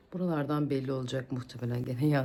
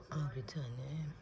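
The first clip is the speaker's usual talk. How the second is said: whispered, softer offer; 4 semitones lower, 10 dB quieter than usual. Real, not acted. Mother whispering while feeding her baby. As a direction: hush it as you feed her.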